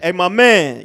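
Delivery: loud, high mock holler at a stranger; imitated shout to get someone's attention; street call-out. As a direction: holler it down the block. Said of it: "When you hear that shit".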